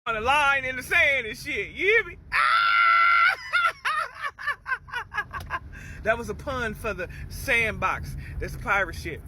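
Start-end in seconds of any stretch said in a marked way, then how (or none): 2.2-6.0 loud, high cackling laugh; high shrieking laugh. Real, not acted. Laughing at his own sandbox pun, shrieking laugh. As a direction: crack up hard at your own pun.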